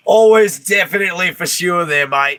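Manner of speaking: high-pitched Aussie voice breaking into a laugh; loud Aussie opening, easing into a chuckle; Australian accent. Pretend. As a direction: put on a playful Australian accent. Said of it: "in the Australian accent".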